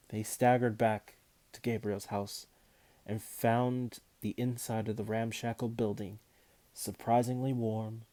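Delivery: ordinary delivery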